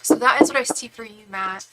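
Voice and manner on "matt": normal talking voice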